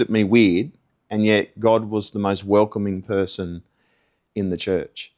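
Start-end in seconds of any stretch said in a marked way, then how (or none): none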